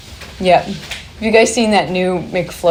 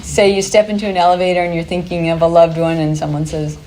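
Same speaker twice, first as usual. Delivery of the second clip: ordinary talk, nothing marked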